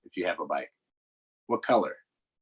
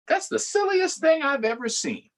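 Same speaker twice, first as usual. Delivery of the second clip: high, incredulous cow; can you believe this duck; amused cow; 14 semitones higher than usual. Acted. Reading the cow's thought to kids, high and amazed.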